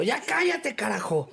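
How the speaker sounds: mock angry yell. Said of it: "me gritó bien feo".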